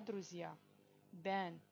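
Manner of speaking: normal talking voice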